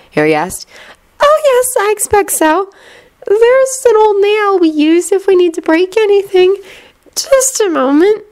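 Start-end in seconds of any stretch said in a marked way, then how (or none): 1.2-8.2 put-on high, airy girl's voice; calm and far away; dreamy distant voice. A narrator's reading. Luna's voiced dialogue.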